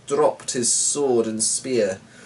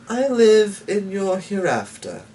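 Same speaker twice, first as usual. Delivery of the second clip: higher, airy and smooth; sly stranger; 8 semitones higher than usual. A narrator's reading. Mysterious stranger greeting the pair, airy and smooth. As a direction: greet them too sweetly.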